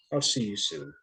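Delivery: ordinary delivery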